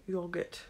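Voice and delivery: "yogurt" said softly as British "yog-ut"; short rounded o in "yogurt", British style; British pronunciation. Shown as a demonstration. Vlogger admiring how British people say it, British "yogurt" with a short o. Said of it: "how British people say the word".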